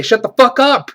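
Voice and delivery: loud, high, fed-up yell; exasperated outburst. Real, not acted. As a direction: shout it like you really mean it. Reviewer echoes the line as his own.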